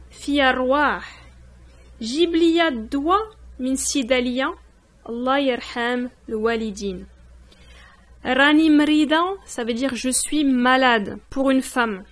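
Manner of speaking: normal talking voice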